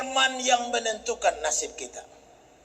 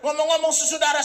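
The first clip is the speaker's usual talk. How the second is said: usual speaking voice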